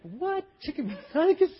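high-pitched incredulous questions; mock disbelief. Emergency dispatcher hearing the complaint, mock disbelief. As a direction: echo it back in disbelief. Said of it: "They can't believe on the other end".

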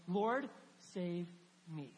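sincere, unadorned plea; simple earnest prayer. Shown as a demonstration. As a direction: pray it plainly, with a pause after "Lord".

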